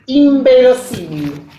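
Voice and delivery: Inverosímil said slowly as imberosímil; phonetic reading. Shown as a demonstration. Teacher showing the phonetic realization, slow and clear. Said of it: "suele realizarse fonéticamente".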